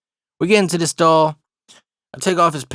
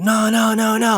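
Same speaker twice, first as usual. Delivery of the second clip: mock panicked wail, held high; freaking-out child; 7 semitones higher than usual. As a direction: imitate a small boy freaking out. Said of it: "he's just freaking out like".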